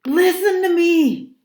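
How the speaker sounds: high, loud, drawn-out shout; shrill, panicky yell; impatient scream. A narrator's reading. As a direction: scream it, demanding to be heard. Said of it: "I screamed impatiently".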